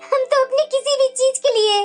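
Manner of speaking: aggrieved lament with sobbing breaths; tearful complaint. Acted. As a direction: plead your case to your husband through tears. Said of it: "तुम्हारी आंखों में आंसू क्यों है". (सिसकते हुए दुखी स्वर में)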